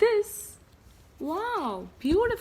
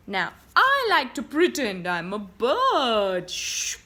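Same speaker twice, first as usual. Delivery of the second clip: ordinary delivery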